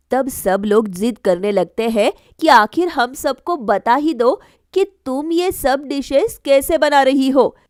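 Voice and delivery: ordinary delivery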